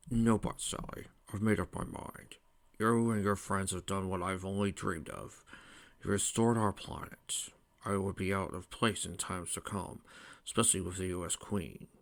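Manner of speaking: soft, whispery fatherly voice; hushed, weary-paced royal farewell; resolved old king. A narrator's reading. King Acorn explains leaving to his daughter, resolved.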